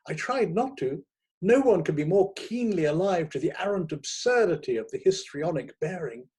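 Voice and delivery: ordinary delivery, nothing marked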